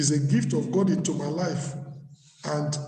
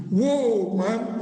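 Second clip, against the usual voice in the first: husband's groan; 3 semitones higher than usual. Retold or imitated. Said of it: "what is your husband going to say".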